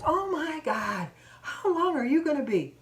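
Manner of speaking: high, drawn-out exasperation; exasperated complaint. Retold or imitated. Customer behind moans about the slow checkout, exasperated. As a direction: imitate an impatient customer moaning.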